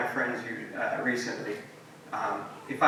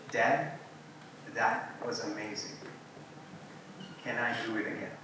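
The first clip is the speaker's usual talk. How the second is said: soft, hushed retold child's wonder; small and sincere, with long pauses; quiet awe; 2 semitones lower, 11 dB quieter than usual. Five-year-old back from giving away his toy asks his dad, softly awed.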